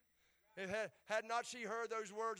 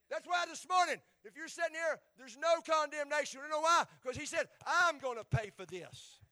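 loud, high appeal to the pews; fervent preaching; 5 semitones higher, 8 dB louder than usual. Acted. Pastor assuring the congregation of no condemnation, fervent. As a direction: lift it up, preach straight to them.

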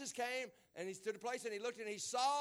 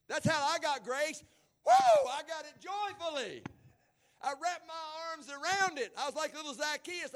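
joyful testimony; 4 semitones higher, 9 dB louder than usual. Acted.

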